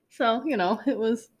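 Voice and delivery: normal talking voice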